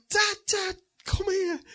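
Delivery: put-on high, loud child-like calling; imitated excited kids. Kids bouncing on the bed calling dad, high and excited. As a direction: mimic children squealing for their dad. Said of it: "they were bouncing on the bed".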